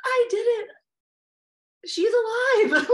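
high, amazed, joyful exclamation; re-enacted elation. Mother who just caught her own baby, elated. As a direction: exclaim it high and loud, overjoyed.

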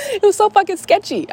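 high, squealing laugh through the words. Real, not acted. Amused at her own PayPal story, laughing.

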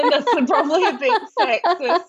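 high, laughing apology. Real, not acted. Host catching her own slip, laughing high. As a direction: laugh through the admission. Catches herself mid-remark.

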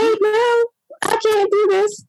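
high, frightened cry; panicked. Retold or imitated. Mother in labor to husband, frightened and high. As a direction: plead that you cannot go on. Fear kicked in, baby retreated.

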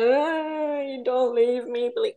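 high-pitched mock wail; mock crying. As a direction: imitate a man tearfully begging, hammed up. Mimics a man crying and begging.

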